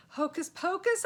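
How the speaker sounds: high, loud desperate shout; shouted spell. Acted. Joe shouts a spell as the knight charges. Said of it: "I shouted".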